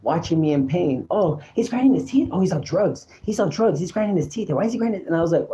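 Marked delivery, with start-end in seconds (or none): 1.1-5.0 high mimicked voices of bystanders; gossiping onlookers. People spying on a man in pain, mimicked gossip voices. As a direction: imitate people passing judgment on him. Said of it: "I could hear people talking about me".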